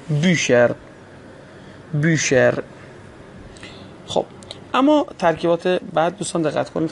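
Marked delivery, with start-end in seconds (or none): 0.1-2.5 بیشر with long rounded ü and "sh"; model reading. Shown as a demonstration. Teacher repeats the example for learners, بیشر with rounded ü. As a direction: model بیشر as a separate, clear word.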